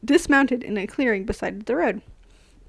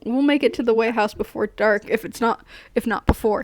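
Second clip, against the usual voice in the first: usual speaking voice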